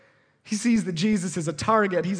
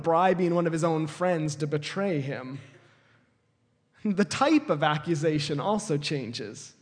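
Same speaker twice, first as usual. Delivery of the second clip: usual speaking voice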